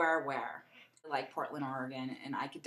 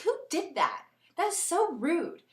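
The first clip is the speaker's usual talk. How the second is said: appalled; 10 semitones higher, 3 dB louder than usual. Retold or imitated. Retelling her reaction to the sign, highly offended. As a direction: sound personally scandalized. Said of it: "I was like".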